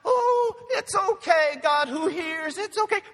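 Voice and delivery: very high, loud mock cooing to a crying baby; hammed-up mother hushing her infant; soothing a baby. Mother hushes her crying baby, very high and loud.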